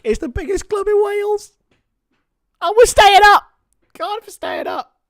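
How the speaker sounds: high, loud proud boast; defiant cheer. Real, not acted. Fan answering a viewer, proudly boasting about Cardiff. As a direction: shout it proudly for the club. Defending his club to chat.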